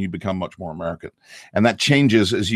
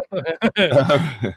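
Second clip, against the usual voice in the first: teasing drawl; 6 semitones higher than usual. Pretend. Teasing remark.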